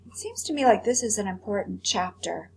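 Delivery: ordinary delivery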